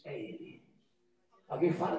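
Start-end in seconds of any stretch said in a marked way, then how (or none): 0.0-0.7 very low, soft murmur; hushed recognition. Retold or imitated. Father spotting his returning son, hushed recognition. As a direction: say it low, almost to yourself.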